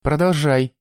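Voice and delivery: high-pitched, eager order; commanding. A narrator's reading. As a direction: order the torturer onward. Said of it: "велел он Гессу". (повелительно)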